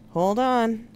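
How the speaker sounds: a touch of urgency, loud; urgent warning. Acted. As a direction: call out a quick warning. Warning before pushing chair fast.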